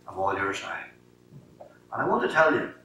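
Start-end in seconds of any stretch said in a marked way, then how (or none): 0.1-1.7 tender surrender. Retold or imitated. Surrender prayer after giving up football.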